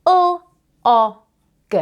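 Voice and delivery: each sound "U, O, G" said separately, high and loud; sounding out words. Shown as a demonstration. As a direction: sound out "U, O, G" letter by letter. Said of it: "I'm going to sound out each word".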